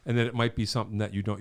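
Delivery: ordinary talk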